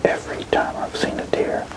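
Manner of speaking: hushed hunting whisper. Real, not acted. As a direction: whisper so the deer won't hear. Whispered hunt update.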